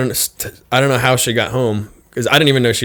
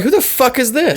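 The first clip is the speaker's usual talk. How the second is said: high, incredulous question with a laugh in it; amused mock outrage; retold disbelief; 12 semitones higher than usual. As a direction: replay your bafflement, pitched way up. Retelling shock at the stranger.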